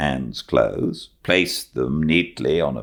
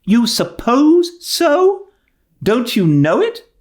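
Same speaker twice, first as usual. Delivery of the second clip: much higher and louder, slow incredulous demand; indignant retort; 6 dB louder, slower than usual. Acted. Marilla challenging Matthew's doubt, high and loud. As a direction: pounce on his hesitation.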